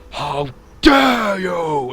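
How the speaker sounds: high, loud, drawn-out outraged cry; acted indignant bellow; indignant shout. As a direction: roar it in outrage, stretching the words. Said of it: "his eyes just light up with indignation".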